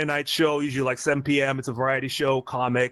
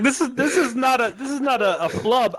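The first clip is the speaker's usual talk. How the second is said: robot voice; 7 semitones higher than usual. Pretend. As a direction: talk like a glitchy robot. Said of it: "I do sound like a robot now".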